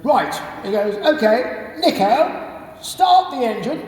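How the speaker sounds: put-on high, hearty old RAF chap; rally the chaps like a cheery ex-RAF officer; jolly RAF type. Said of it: "and goes".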